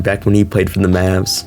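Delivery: usual speaking voice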